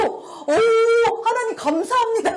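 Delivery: high, tearful-sounding cry of praise; overjoyed thanks. Retold or imitated. Thanking God as joy springs up, high overjoyed cry. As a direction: draw out the O, then gush thanks. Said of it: "그게 막 생기는 거예요".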